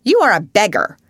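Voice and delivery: harsh headmistress losing her temper; temper rising. Acted. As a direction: spit out the insult in rising temper. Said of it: "her temper rising".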